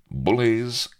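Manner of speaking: Calico Jack hailing the assembled pirates, loud and high; calling out. Acted. Pirate captain addresses his crew, calling out.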